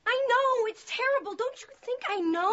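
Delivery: anguished outburst. Acted. Confronted by her sister, anguished outburst. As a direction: burst out defensively at your sister. Sisters quarrel, confession of betrayal.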